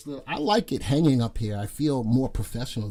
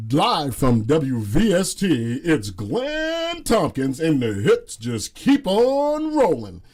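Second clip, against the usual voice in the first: slow, louder radio DJ announcer; disc jockey; 2 semitones higher, 8 dB louder, slower than usual. Pretend. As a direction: boom it out like a radio host. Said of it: "I feel like a disc jockey".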